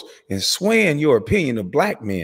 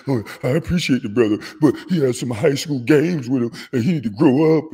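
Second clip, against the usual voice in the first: mocking impression. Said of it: "'cause you say a few nice words to me".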